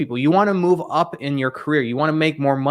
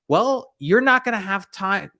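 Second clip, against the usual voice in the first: ordinary talk, nothing marked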